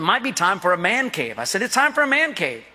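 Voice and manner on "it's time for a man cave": higher, playful announce; mock declaration. Pastor announcing a man cave to his wife, playful. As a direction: proclaim it to the wife. Quoting himself to Wendy.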